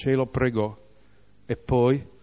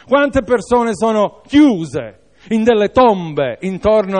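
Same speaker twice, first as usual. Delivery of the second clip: raised, forceful preacher's voice; emphatic preaching with punched phrase ends; impassioned preaching; 6 semitones higher, 5 dB louder than usual. Real, not acted. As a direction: drive each phrase home, high and loud.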